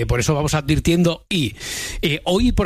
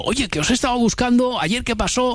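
mock listener message; 8 semitones higher than usual. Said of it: "algún mensaje diciendo".

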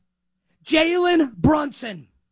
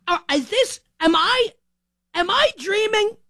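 disbelief; 5 semitones higher than usual. Retold or imitated. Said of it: "you're thinking".

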